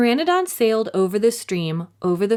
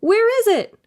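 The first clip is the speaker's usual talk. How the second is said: put-on panicked boy; 14 semitones higher, 6 dB louder than usual. A narrator's reading. Jack searching in panic.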